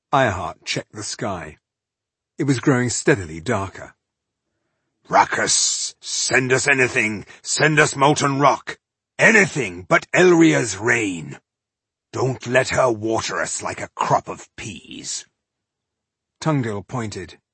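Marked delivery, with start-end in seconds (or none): 5.1-15.3 dwarf's emphatic plea to the heavens; pleading dwarf. Acted. Dwarf begging to be spared the rain, imploring. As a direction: implore the gods in a dwarf's voice, drawing out the dread.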